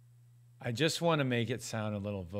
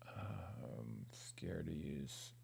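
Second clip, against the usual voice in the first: whispering; 10 semitones lower, 15 dB quieter, much slower than usual. Real, not acted. Muttering to himself while working.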